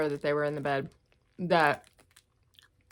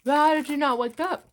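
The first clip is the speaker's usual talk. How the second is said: incredulous; 9 semitones higher, 5 dB louder than usual. Real, not acted. Outraged question to viewers.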